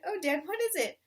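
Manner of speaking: put-on high excited child voices; eager cubs. Cubs begging father to share his plan, excited. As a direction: beg to hear the secret.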